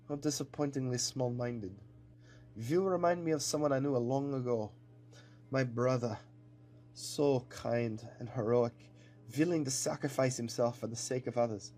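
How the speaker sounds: put-on accented villain, measured and mournful; villain captain, regretful. Captain pleads with cowboy who reminds him of his brother, regretful villain. Game or story villain's speech.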